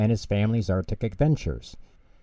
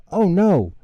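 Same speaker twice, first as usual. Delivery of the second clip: alarmed oh no; 9 semitones higher, 7 dB louder than usual. Acted. Pingu realises the loose wheel.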